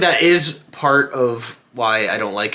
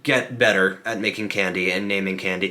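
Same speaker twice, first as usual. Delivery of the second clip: normal talking voice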